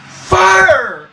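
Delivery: sudden high shout; ghost yells fire. Retold or imitated. Ghost in his face, yelling fire again. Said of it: "And she yells it again".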